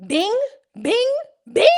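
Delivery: short, high rising dings; imitated chime. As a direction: ring each one out loud and rising, like a bell. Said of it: "that's not what that sound is".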